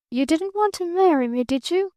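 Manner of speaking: pitched well up, a wary blunt question. A narrator's reading. Girl asks Barton if he wanted to marry her, high and wary. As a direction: ask it straight out, high.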